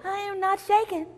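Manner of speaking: put-on high, loud little-girl denial; defensive storybook little girl; protesting little girl. Girl denies fear to wolf.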